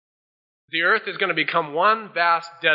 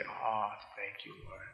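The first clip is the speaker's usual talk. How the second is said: hushed, breathy thank-you, much softer; whispered thanks; 8 semitones lower, 18 dB quieter, slower than usual. Retold or imitated. Congregation thanking the Lord in a hushed whisper. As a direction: breathe it like a quiet prayer. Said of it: "we can say".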